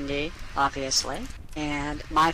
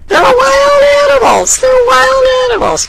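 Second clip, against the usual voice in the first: much higher, loud exasperated shout; 16 dB louder than usual. Real, not acted. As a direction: yell it out in disbelief. Exasperated at bad advice to hug animals.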